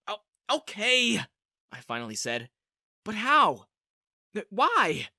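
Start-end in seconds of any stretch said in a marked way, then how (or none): 0.1-1.2 slow, higher, louder "okay"; hesitant, strained consent; reluctant giving in. A narrator's reading. Reluctantly gives in to plea. 3.0-5.1 higher, loud, halting questions; baffled outburst with a stumble; bewildered questioning. A narrator's reading. Bewildered questions after agreeing.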